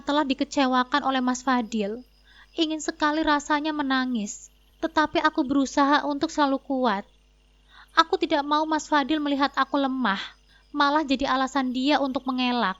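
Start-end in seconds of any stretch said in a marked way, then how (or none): none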